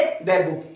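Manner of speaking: "द book" with "द" as a short "thuh"; "the" before a consonant. Shown as a demonstration. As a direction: say "द book", the article a quick "thuh". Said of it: "क्या पढ़ेंगे?".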